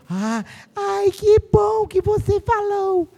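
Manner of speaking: mock a grateful teacher; relieved teacher.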